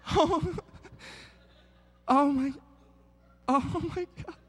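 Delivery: high, panicked gasps of embarrassment; mock panic. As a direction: play a horrified parent caught off guard, voice jumping up. Panicked parent in story.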